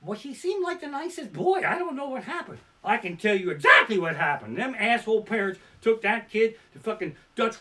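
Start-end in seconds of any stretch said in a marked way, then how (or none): 0.0-2.6 put-on high, sweet old-lady voice; neighbor lady. Neighbor lady telling the news crew, high sweet voice. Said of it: "and she goes".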